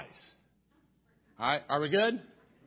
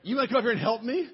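quick, louder, higher playful jab; playful teasing; 8 semitones higher, 6 dB louder, much faster than usual. Real, not acted. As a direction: tease her from the pulpit. Teasing wife from pulpit.

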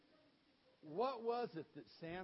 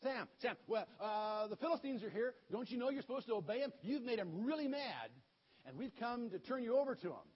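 louder and higher, hollered explanation; calling out; 6 semitones higher, 8 dB louder than usual. Retold or imitated. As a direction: shout the news down the cave. Men calling into cave.

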